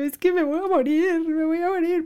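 put-on high, wailing little girl; imitating herself sobbing as a kid, pitched high; crying child. Little girl wails to her mom, sobbing high. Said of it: "yo llorando así".

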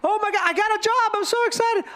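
high, quick burst of excitement; excited. Acted. New employee bursting with job news, excited. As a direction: gush it out in a high voice. Acting out joy at good news.

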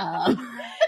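short laugh; quick real giggle. Real, not acted. Laughs after theory.

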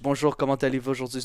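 quick, fluent French pronunciation; fast French. Shown as a demonstration. Speaker shows quick language switching, in rapid fluent French. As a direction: rattle off the French greeting. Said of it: "switching between languages really fast".

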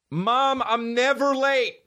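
very high, drawn-out whine back; imitated protesting kid. Him as a kid answering mom, high protesting mock whine.

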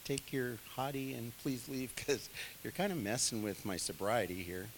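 a timid Pharaoh politely asking Abram to go; overly polite. Retold or imitated. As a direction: ask him to leave far too nicely. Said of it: "I bet it wasn't".

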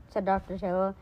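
usual speaking voice

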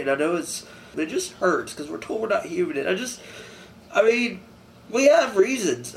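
normal talking voice

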